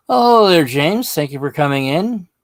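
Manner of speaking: loud, high, cheerful hello; excited greeting. Real, not acted. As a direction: call out a bright welcome. Greeting livestream viewer loudly.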